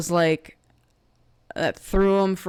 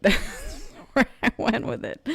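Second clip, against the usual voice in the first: breaking into a laugh at oneself; real, self-amused laughter; 4 semitones higher than usual. Real, not acted. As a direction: laugh through the words, amused at your slip. Laughing at own accidental accent.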